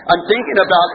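ordinary talk

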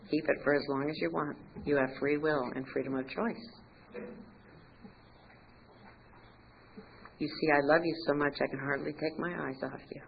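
soft, low, loving voice; tender reassurance. Retold or imitated. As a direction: reassure quietly, with unhurried pauses. God's voice reassures her lovingly.

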